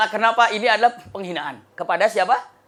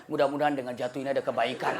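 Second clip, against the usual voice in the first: lower, softer, laughing calm reply; calm husband; 7 semitones lower, 6 dB quieter, faster than usual. Acted. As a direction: answer dryly and softly.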